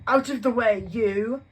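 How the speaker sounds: high, loud bullying snarl; rude shove-off. Acted. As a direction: bark it at Harry while barging past. Dudley shoves Harry aside.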